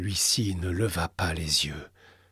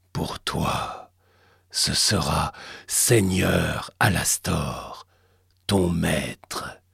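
menacing entity whisper; 4 semitones lower, much slower than usual. Acted. The entity demands submission.

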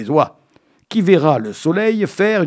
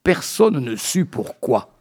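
normal talking voice

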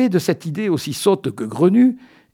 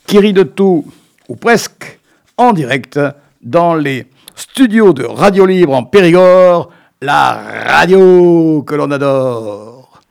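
loud, drawn-out announcer voice for the show opening; hammed-up radio intro; 9 dB louder than usual. Host opening his daft chronicle on local radio, loud slowed announcer delivery. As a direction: sell the show like a cheesy radio jingle.

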